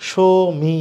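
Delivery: ordinary delivery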